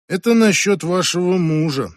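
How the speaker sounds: plain reading voice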